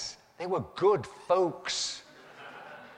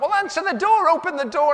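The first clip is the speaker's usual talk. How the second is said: high, loud bossy order; hammed-up wife barking orders; wife ordering husband to answer door; bossy wife shout; 12 semitones higher, 8 dB louder than usual. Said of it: "she's going".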